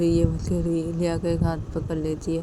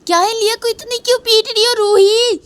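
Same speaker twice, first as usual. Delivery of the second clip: much higher shrill-pitched anxious calling; grandmother calling; 6 dB louder than usual. Acted. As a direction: call through the door in a panic. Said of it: "knock करते करते रूही को बोलती". (घबराई दादी की ऊँची पुकार)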